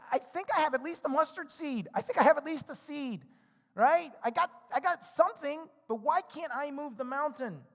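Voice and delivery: a light plea, puzzled; pleading doubter. Retold or imitated. Preacher voicing his own doubting prayer.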